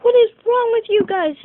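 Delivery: loud, high scolding shout; mom's angry yell. Retold or imitated. Mom scolding her kids with a loud high angry shout. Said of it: "she was mad at us".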